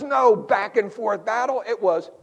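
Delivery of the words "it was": ordinary delivery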